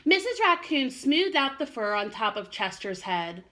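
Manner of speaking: ordinary delivery, nothing marked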